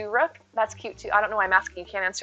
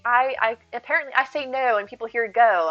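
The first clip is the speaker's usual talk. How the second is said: ordinary talk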